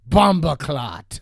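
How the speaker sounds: slow, loud Jamaican patois imitation; loud, slow mock Jamaican accent; Jamaican patois quote. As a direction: mimic a big guy's Jamaican greeting, drawn out. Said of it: "He goes".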